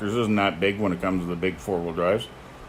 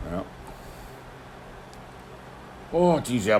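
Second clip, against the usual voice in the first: usual speaking voice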